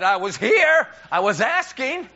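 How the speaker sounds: high, loud, stretched-out insistence; hammed-up marital exasperation; acted indignant protest. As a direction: play a frustrated husband pleading his case loudly. Retold husband protesting in quarrel.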